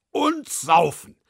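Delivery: loud, slow drawn-out ox; boozy ox. Acted. Ox adds boozing, loud and slow. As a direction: bellow it like a tipsy ox. Ox wants to booze.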